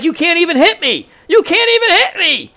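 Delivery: high, loud mocking jeer, building on the repeat; [name]. Batter taunts the Mets pitchers, screaming and jeering. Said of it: "[name] is taunting the Mets".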